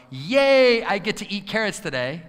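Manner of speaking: high, loud mock excitement; fake cheer. Shown as a demonstration. Coach mocking forced positivity about carrots, hammed-up cheer. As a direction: overdo it like a cheerleader. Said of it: "I don't want you to go".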